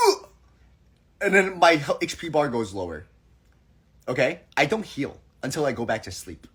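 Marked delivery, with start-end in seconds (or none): 0.1-0.3 short fed-up groan; disgusted groan. Retold or imitated. Her reaction to having to do laundry, fed-up groan. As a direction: let out a quick annoyed ugh.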